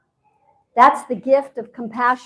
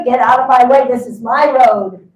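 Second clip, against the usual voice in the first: mock road-rage yelling, much louder; 8 dB louder than usual. Push everybody out of my way.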